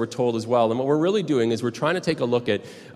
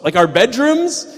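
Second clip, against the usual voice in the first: much higher and louder, drawn out slowly, rising at the end; horrified squeak of disbelief; shocked disbelief; 13 semitones higher, 8 dB louder, slower than usual. Retold or imitated. [name] asking the worker if the intruder showed the bedrooms, in high shocked disbelief. [name] hears the whole house was toured.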